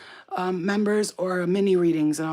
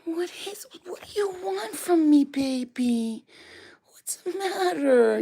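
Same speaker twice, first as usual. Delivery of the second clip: high cooing pet voice, much higher and louder; 11 semitones higher, 4 dB louder, slower than usual. Real, not acted. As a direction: coo up high to a frightened puppy. Talking to scared pet dog.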